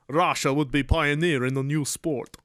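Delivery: put-on Russian-accented villain voice; Gru voice. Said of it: "he also sounds like Gru".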